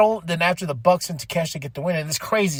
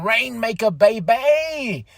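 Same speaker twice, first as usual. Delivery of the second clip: ordinary delivery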